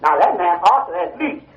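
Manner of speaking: normal talking voice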